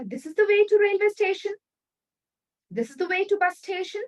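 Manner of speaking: "This is the way to railway station" said high as a flat statement; plain statement, not ideal. Shown as a demonstration. Teacher shows students the blunt statement to avoid. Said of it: "is what we ideally use".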